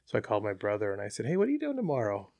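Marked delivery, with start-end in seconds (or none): 1.3-2.3 quick, pitched-up casual question; bright high teasing ask. Retold or imitated. Calling his brother after the kill, playfully high. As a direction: sound like you're hiding good news.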